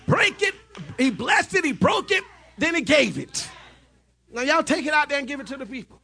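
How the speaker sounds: high, loud shouted sermon; fiery revival preacher, shouting the climax; full-force preaching. Acted. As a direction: preach it out to the crowd at the top of your voice.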